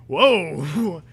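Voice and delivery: a high, loud, startled whoa; mock startled yell; hammed-up yell. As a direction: blurt it out like a fake fright. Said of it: "I yelled".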